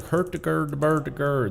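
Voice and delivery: high sing-song mock-Swedish gibberish; Swedish Chef. Streamer showing how the Muppets dude talks, mock-Swedish babble. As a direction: imitate a Muppet cook's Swedish-sounding babble. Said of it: "That's like the Swedish chef".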